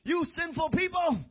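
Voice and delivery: high-pitched mock condemnation of sinners; mock scolding. Preacher parodies what Scripture doesn't say, high mock scolding. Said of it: "It doesn't say".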